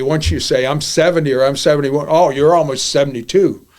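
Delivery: ordinary delivery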